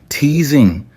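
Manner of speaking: ordinary delivery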